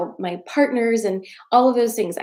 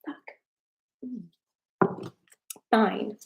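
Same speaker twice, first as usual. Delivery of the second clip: ordinary delivery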